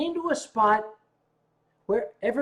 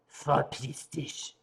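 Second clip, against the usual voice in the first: put-on grumpy old man; 4 semitones lower, slower than usual. Disguised old man dismisses Germans.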